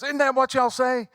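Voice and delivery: high, eager fan voice; high earnest gushing question; lady fan. Retold or imitated. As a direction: ask sweetly like a fan. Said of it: "she goes".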